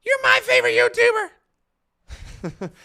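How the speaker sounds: slow, loud falsetto squeal; gushing falsetto fan squeal; squeaky high voice. Real, not acted. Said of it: "I don't know why my voice went like that".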